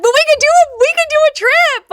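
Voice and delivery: high, loud burst of excitement; thrilled at the idea; excited. Real, not acted. Excited about a group trip.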